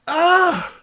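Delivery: high, loud fake wail; hammed-up cry of despair; mock anguished scream. Host at the end of mock meltdown, high anguished scream. Mock breakdown over played clip.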